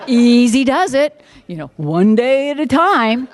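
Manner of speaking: high, loud, slow sarcastic sing-song; mocking slogans. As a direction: drag the clichés out with scorn. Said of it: "the stupidest, tritest".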